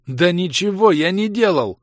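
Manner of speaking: high, loud slow outburst; exploding. A narrator's reading. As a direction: explode in protest. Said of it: "Взорвался я".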